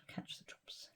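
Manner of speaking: faint trailing whisper; barely audible whisper. Real, not acted. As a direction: let it fade almost to nothing. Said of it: "need to catch the drops".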